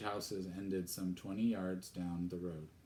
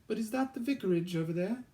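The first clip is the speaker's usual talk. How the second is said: put-on high, quick girl's question; young girl's voice; 14 semitones higher, 5 dB louder, faster than usual. Girl asks old gardener about vicarage, high and quick. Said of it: "she said loudly".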